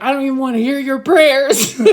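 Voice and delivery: high, loud comic rejection; hammed-up divine outburst; mock-exasperated God. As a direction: playfully shout them down, breaking into laughter. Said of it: "And he's like".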